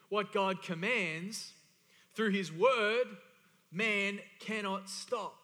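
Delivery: very slow, every word weighted; emphatic declaration. Real, not acted. Preacher repeats line for note-takers, slow and emphatic. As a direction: hammer out "What God commands", slowly and forcefully.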